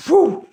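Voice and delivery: high, loud whistle of relief; dog's relieved whew. Acted. Dog skeleton relieved to be put right. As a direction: blow out a big relieved whew. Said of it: "said the dog skeleton".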